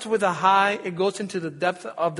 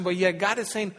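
usual speaking voice

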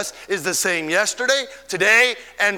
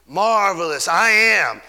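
ordinary talk

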